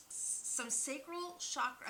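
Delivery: halting, tripping start on the word; stuttering. Real, not acted. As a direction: stumble over the first sound. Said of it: "sorry that I'm stuttering".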